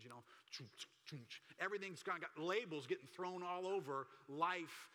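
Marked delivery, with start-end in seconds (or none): 0.5-1.4 soft, low chunking of a label maker; label machine noise. Retold or imitated. Preacher mimics little label machines throwing labels, imitated chunking noise. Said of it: "those little label machines".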